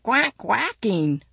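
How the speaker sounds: duck voice. A narrator's reading. Ducks quacking in traffic.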